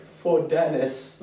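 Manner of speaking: high, drawn-out heroic cry; hammed-up slow rallying cry; mock battle cry. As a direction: stretch it out like a dedication before battle. Old man's solemn battle cry.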